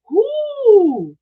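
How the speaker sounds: high, loud, drawn-out "woo"; excited whoop. Real, not acted. Host cheers at a returning viewer, excited whoop.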